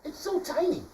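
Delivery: mouse voice. Acted. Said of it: "the little mouse said".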